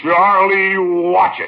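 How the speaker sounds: high, indignant warning; angry warning. Acted. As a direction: warn him off sharply. Quarrel with Charlie on the phone.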